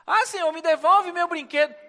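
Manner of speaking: put-on high, loud begging like a child; childish whining. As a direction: whine for the toy back. Believer whining to God. (fiel choramingando como criança)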